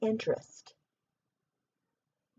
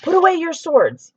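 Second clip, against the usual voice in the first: louder call; king yelling to put swords away; 6 semitones higher, 8 dB louder than usual. Acted. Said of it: "yelled King Henry the Egg".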